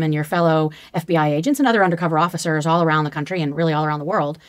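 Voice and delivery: ordinary delivery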